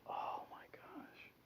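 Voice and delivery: slow, breathless hushed oh my gosh; whispered shock. Acted. Jesus facing the leper up close, slow whispered shock. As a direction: gasp it under your breath.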